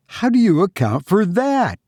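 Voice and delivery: high, dread-struck question; understated dread in a high voice; awful voice. A narrator's reading. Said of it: "in an awful voice".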